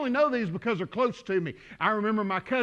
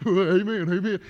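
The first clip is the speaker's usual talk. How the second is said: lightly imitated congregant's amen; quick amens, mimicking a brother in the pews; Kevin. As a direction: do an impression of his habitual amen. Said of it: "He'd be going".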